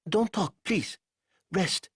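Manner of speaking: lightly voiced Ko calming him; Ko soothing. A narrator's reading. Ko soothes Banks.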